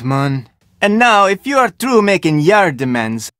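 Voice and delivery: normal talking voice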